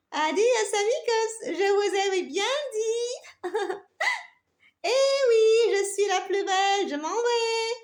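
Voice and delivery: gloating with a high laugh; proud. Acted. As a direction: crow over the others, laughing. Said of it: "toute fière".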